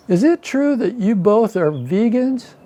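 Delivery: high-pitched, inquisitive Southern lady; little old Southern lady. Retold or imitated. Southern lady asking a couple's husband about veganism, high old-lady voice. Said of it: "a little old Southern lady".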